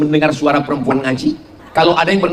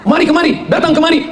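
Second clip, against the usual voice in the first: high-pitched, eager summons; calling out; 10 semitones higher than usual. Acted. Angel calling fellow angels to come here, calling out. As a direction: beckon friends over with raised pitch. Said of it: "dia memanggil kawan-kawannya malaikat".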